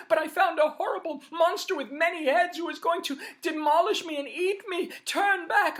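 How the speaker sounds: screamed high and loud in panic; fleeing sister screaming a warning about the monster; terrified warning. Acted. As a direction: run out shrieking at your sister to flee. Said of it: "she heard screaming".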